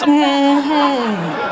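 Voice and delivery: long drawn-out knowing hum; drawn-out amen-style mm-hmm; knowing grown-up agreement. Retold or imitated. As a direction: imitate grown-ups humming in heartfelt agreement. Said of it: "look at all the grown-ups".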